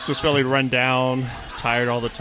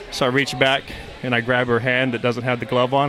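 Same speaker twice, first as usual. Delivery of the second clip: normal talking voice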